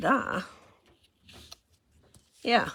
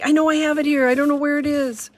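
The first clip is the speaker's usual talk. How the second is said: higher, louder flustered searching; mock panic over a lost tool; 9 semitones higher, 6 dB louder, faster than usual. Telling Julie she can't find it, higher flustered panic. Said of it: "I was like".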